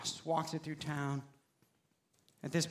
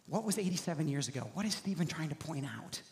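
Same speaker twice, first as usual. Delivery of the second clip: confused listener; 8 dB quieter, faster than usual. Retold or imitated. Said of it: "not any of you would be like".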